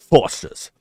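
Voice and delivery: loud mock-Australian ad voice; Aussie accent. Shown as a demonstration. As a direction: punch it out in a put-on Aussie accent. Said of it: "a smidge of a Aussie accent".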